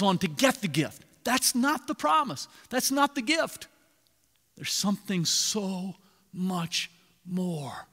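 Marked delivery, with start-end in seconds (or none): 4.6-7.9 slow hushed whisper. Real, not acted. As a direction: lean in and whisper it slowly. Preacher drops to a whisper.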